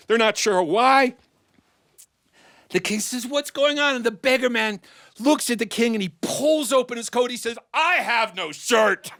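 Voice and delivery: high-pitched, excited comic narration; animated storytelling. A narrator's reading. Storyteller relays two men arguing over the crown, animated. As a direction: tell it high, building the farce.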